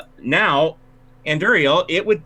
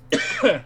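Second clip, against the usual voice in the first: fake cough. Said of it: "She coughs".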